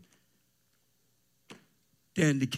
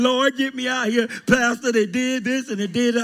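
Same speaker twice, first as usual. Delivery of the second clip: mock whining prayer; 11 semitones higher, 10 dB louder than usual. Mocking a whining fired believer.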